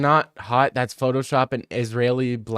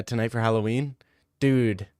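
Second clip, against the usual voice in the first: ordinary talk, nothing marked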